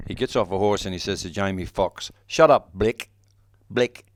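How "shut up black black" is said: Quentin's botched Aussie, sounding South African; mock South African accent. Actor mimicking the director's bad take, South African-sounding accent. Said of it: "Sounded like a South African".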